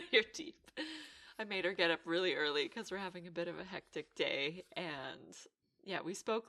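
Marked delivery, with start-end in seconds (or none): 0.0-0.6 giggling through the words; laughing. Real, not acted. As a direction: bursts out laughing. Laughing at friend's morning voice.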